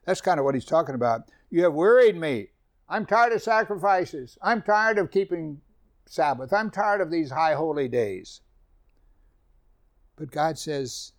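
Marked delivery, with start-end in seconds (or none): 1.5-8.4 a touch higher, louder declaiming; proclaiming scripture. Acted. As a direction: voice God's tired complaint. God's complaint quoted.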